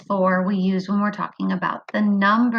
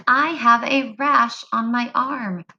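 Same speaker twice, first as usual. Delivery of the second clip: normal talking voice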